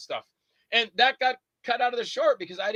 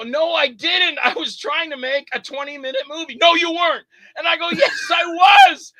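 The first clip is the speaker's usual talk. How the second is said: higher, louder back-and-forth, building; heated argument; 11 semitones higher, 8 dB louder than usual. Retold or imitated. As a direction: argue it out, rising to a shout. Retold quarrel with Phil.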